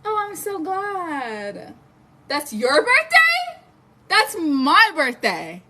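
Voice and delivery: slow, high delighted squeal; thrilled squeal. Real, not acted. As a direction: stretch it out in delighted surprise. Shares birthday with viewer.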